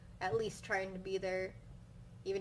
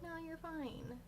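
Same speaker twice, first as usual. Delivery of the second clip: dismissive; 8 semitones higher, 7 dB quieter, slower than usual. Retold or imitated. Dismissive grandpa's wife quoted.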